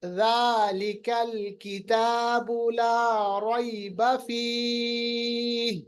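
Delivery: slow, chanted tajweed reading; Quran recitation. Shown as a demonstration. Teacher models the verse, slow recitation. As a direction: recite it slowly, letters held. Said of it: "جب میں پڑھوں گا نا".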